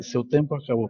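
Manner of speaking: ordinary talk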